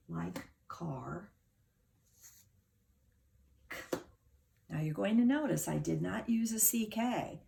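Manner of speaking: ordinary delivery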